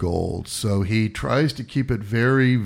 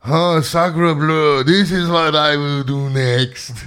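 exaggerated high, loud French accent; hammed-up French accent, slow; french chef; 5 semitones higher, 5 dB louder, slower than usual. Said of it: "He says".

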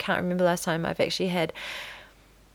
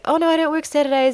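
high, louder people-pleasing reply; apologetic; 9 semitones higher, 5 dB louder, faster than usual. Retold or imitated. Flustered woman answering her neighbor, apologetic. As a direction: pitch it up high, eager to excuse yourself. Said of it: "be apologetic for".

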